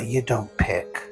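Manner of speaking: usual speaking voice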